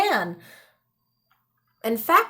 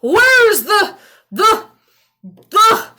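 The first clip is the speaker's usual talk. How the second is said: high, loud holler that gets stuck; hollered, losing the word; 9 semitones higher, 8 dB louder, slower than usual. Acted. Old man hollers at the rapscallion and forgets the word. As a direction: holler it, then stumble searching.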